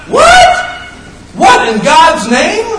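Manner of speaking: very high, loud, slow astonished cry; shocked disbelief. Retold or imitated. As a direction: yelp in disbelief at the madness. Mock disbelief at chaos.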